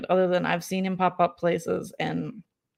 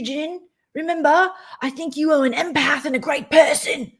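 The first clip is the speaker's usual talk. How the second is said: higher, louder patronizing lecture; mock condescending voice; 7 semitones higher, 5 dB louder than usual. Mocking the fish lecturing Jen, higher and patronizing. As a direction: talk down like a fake mentor. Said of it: "I have outbursts".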